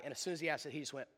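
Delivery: normal talking voice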